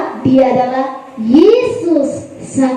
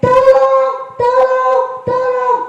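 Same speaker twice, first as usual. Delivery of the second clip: very high, rising frantic calls; storyteller's frightened disciples calling for help; acted cry for help; 11 semitones higher, slower than usual. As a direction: cry out like sinking in a storm. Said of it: "Kembali mereka berteriak".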